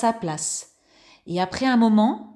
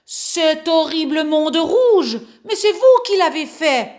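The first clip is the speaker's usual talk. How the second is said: angry shout; 6 semitones higher, 5 dB louder than usual. Acted. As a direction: shout it furiously. Angry neighbours storm house.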